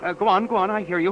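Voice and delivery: radio listener urging the caller on; urgent urging. Acted. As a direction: keep him talking, fast and keen.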